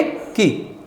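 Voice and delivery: की said very softly, with a short i. Shown as a demonstration. Teacher showing the short vowel, की soft and short. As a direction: keep की small and hushed, ki. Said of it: "धीरे से pronounce करेंगे".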